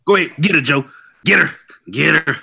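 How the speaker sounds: high-pitched cheering on; goading from the sidelines; egging on. Real, not acted. Egging Joe on to argue.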